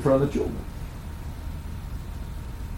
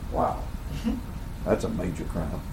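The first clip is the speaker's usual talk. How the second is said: low, quiet mock gravity; deadpan irony; 8 semitones lower, 13 dB quieter than usual. Real, not acted. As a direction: drop it softly as a dry aside. Mocks his own overreaction to a B.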